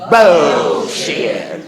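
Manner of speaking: loud Bullshit with a Fort Worth Texas twang; Texan twang. Real, not acted. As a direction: shout it twangy, Texas style. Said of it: "the way we say it in Fort Worth".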